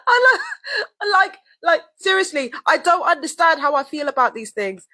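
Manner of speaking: high, strained voice still cracking up; real giggly tears; laughing through tears. Real, not acted. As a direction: talk while barely holding back laughter. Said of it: "I got tears now".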